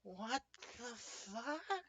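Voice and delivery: soft, slow, high disbelief; stunned guard. Acted. Game master voices guards watching an impossible dodge, stunned and hushed. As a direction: draw it out quietly in high-pitched shock. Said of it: "you can see the guards going".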